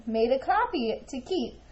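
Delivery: ordinary delivery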